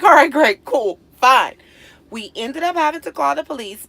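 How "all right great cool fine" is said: fed-up agreement. Retold or imitated. Exasperated reply after being stranded.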